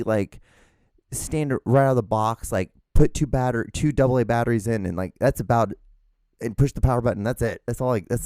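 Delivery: usual speaking voice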